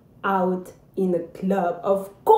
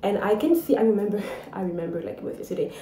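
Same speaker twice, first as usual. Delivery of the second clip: ordinary talk, nothing marked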